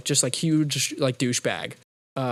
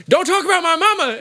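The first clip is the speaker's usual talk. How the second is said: very high, louder strained mock yell; Waterboy outburst; 5 dB louder than usual. Student about to tackle professor, high hammed-up yell. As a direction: explode in a high comic yell. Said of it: "And he goes".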